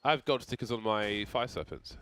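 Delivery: ordinary talk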